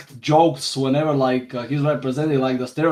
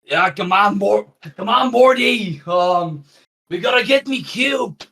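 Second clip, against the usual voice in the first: put-on Australian accent, Rick parody, higher and shouting; 7 semitones higher, 3 dB louder than usual. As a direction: play a rowdy Aussie Rick with a broad Australian accent. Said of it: "For example".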